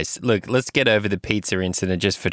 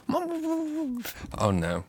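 Missus Fisty gasping; 8 dB quieter than usual. Acted. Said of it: "Then you hear".

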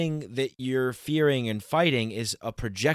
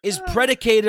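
much higher, louder outburst; excited rant; 11 semitones higher, 6 dB louder than usual. Real, not acted. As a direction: hit the top of your voice in disbelief. Heated rant about Catholicism.

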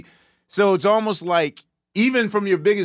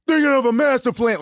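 higher, louder [name] line; imitating [name]; 9 semitones higher, 4 dB louder than usual. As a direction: quote [name]'s lyric, projecting it out. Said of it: "he goes loud".

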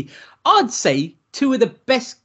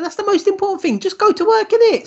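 much higher and louder, rallying; excited cheer; 13 semitones higher, 4 dB louder than usual. Real, not acted. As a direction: whip it up like backing a mate. Cheering on going back to work.

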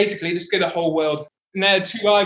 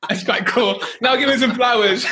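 laughing; 6 semitones higher than usual. Retold or imitated. Quoting girl, laughing through it.

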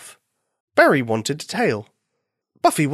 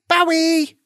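high-pitched call across the yard; bunny's shout; 4 dB louder than usual. A narrator's reading. Bunny hailing the farm dog as he nears, shouting. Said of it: "he shouted as he came nearer".

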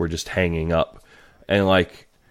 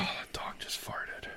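whispering; 17 dB quieter than usual. Real, not acted. Sudden aside about the dog.

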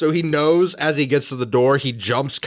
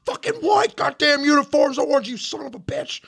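bury the private under furious abuse; drill sergeant yelling; 10 semitones higher than usual. Acted. Chewing out a private in character.

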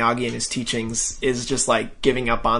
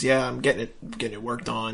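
low-key, trailing off softer; passive answer; 3 semitones lower, 7 dB quieter than usual. Retold or imitated. Reluctant customer answers his old rival, passive. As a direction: fade away as if avoiding the conversation. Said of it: "giving very passive answers".

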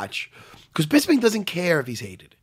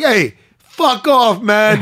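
lightly imitated accent, louder, higher, drawn out; slow, raised-voice mock of a fighter's accent; George; 4 semitones higher, 5 dB louder, much slower than usual. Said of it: "it's great with both their accents".

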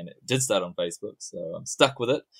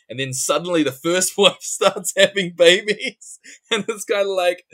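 laughing; 11 semitones higher, 10 dB louder than usual. Real, not acted. Laughing while telling the twist.

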